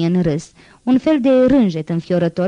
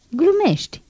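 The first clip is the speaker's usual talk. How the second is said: high sneering outcry; mocking shout; 11 semitones higher than usual. A narrator's reading. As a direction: fling it back in scorn, high. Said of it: "strigă Javert".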